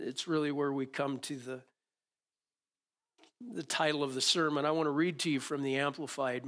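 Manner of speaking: ordinary delivery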